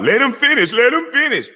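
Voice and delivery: mocking whine. Mocking a debater who keeps asking to let him finish, whining high. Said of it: "It's all about".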